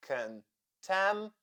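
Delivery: 'Contaminate' in four separated beats; syllable by syllable. Shown as a demonstration. Teacher counting syllables for learners, syllable by syllable. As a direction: pause after each syllable of 'Contaminate'. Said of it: "count the syllables in this word".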